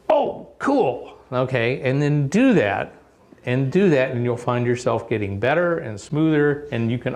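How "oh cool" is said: high-pitched "oh, cool" of delight; mock delighted surprise, pitched way up; acted pleased discovery. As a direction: act out a happy little find. Acts out finding a new contact.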